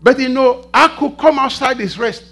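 loud, high pulpit delivery; preaching at full force. Real, not acted. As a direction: drive it home to the congregation.

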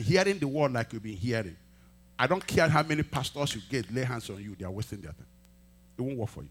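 ordinary talk

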